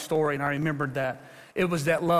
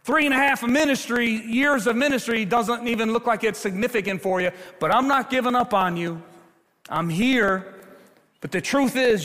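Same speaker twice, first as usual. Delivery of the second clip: louder and higher, pleading rebuke; Jesus rebuking Peter; 5 semitones higher, 3 dB louder than usual. Acted. Jesus confronting Peter on the shore, loud rebuke. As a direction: scold him, then promise not to give up. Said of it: "He says".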